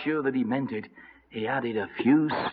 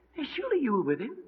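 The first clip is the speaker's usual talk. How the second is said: high, soft, quick question; put-on small high voice, suspicious; probing accuser; 11 semitones higher, 8 dB quieter, faster than usual. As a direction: needle him quietly, pitched up. Second accuser, acted.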